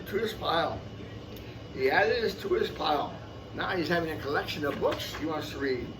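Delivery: ordinary delivery, nothing marked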